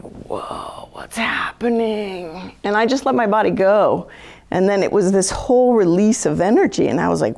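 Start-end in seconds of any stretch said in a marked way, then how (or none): 0.0-2.6 slow, whispered amazement; hushed wonder. Retold or imitated. Healer retelling lying down as energy releases, hushed wonder. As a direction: breathe it out slowly, half-voiceless.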